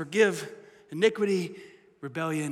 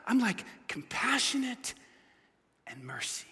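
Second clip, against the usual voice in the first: soft tender God; 11 dB quieter than usual. Acted. God softly telling his nature.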